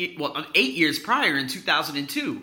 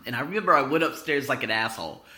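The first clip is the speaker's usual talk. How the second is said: ordinary talk, nothing marked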